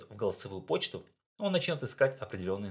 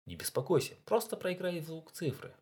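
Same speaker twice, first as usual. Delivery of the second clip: friendly reassuring; 10 semitones higher than usual. Acted. ATC reassures phone again.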